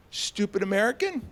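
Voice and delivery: over-the-top apology to win him over; eager groveling. Retold or imitated. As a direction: grovel loudly, pushing the word stupid. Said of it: "I was like".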